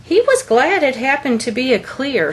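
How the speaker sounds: usual speaking voice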